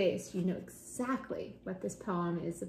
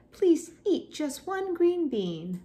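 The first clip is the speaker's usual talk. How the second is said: high, slow coaxing mother; pleading mother; 8 semitones higher, 5 dB louder, slower than usual. Acted. Mother coaxes child at lunch, slow high plea. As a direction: draw out the gentle request. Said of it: "her mother said".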